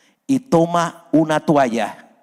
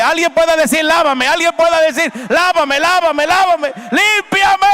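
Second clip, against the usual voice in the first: much higher and louder preaching cry, climbing to the end; full-force preaching cry; 13 semitones higher, 6 dB louder than usual. Acted. Preacher voices a believer begging God to cleanse him, shouting. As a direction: preach it at the top of your voice, building.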